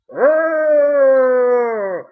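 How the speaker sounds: loud, far-off moaning groan; cultist groan. Retold or imitated. Mocking cloaked cultists with a loud groan.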